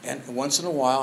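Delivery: normal talking voice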